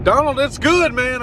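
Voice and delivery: telling the driver the car's fine; excited reassurance. Retold or imitated. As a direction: pump the driver up. Retold encouragement to driver.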